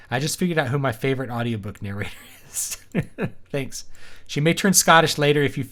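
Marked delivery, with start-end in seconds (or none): none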